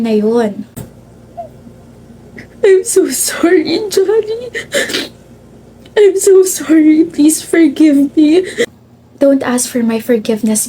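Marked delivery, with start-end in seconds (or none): 2.7-4.5 high, slow, tear-choked plea; sobbing apology. Acted. Grazel begs her dead best friend's forgiveness, sobbing. 6.0-8.4 high, slow, tear-choked begging; sobbing plea. Acted. Grazel begs her dead best friend's forgiveness, sobbing.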